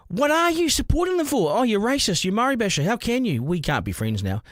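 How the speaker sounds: high-pitched mimicry of indignant critics; mock accusers. Host quoting critics who turned on him, mock indignation. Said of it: "things that I've been called".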